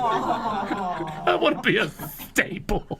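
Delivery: very slow, long whistled S in "staple"; drawn-out, hammed-up whistly impersonation; whistling S impersonation. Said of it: "with a whistling ass".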